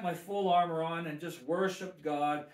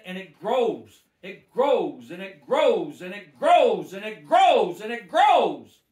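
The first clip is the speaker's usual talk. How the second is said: 2 each grows louder than the one before; 3 pound out grows, swelling to a loud slow finish; 4 sermon crescendo on a repeated line; 1 building preaching; 2 semitones higher, 13 dB louder, slower than usual. Real, not acted.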